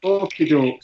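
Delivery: ordinary delivery, nothing marked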